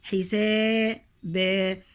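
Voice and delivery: حسابا drawn out with the long a held; stretched alif. Shown as a demonstration. Teacher shows the alif madd to students, slowly stretched. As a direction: hold the final a in حسابا long and slow. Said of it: "مد بالألف".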